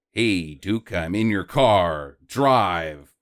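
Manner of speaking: a hint of a low, deliberate tough guy; Charles Bronson. Bronson orders Duke from inside his car, lightly voiced low and slow. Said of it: "Bronson's like".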